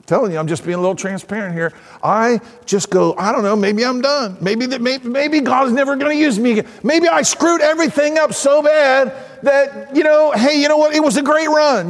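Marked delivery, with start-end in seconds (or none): 3.2-11.9 acted worry, climbing higher; mock-resigned fretting in a higher pitch; self-doubting. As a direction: talk yourself into being finished. Acts out his own self-doubt.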